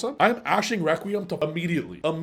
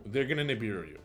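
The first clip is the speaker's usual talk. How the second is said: ordinary talk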